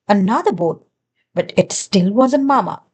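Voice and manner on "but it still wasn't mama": plain reading voice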